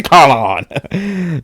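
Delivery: usual speaking voice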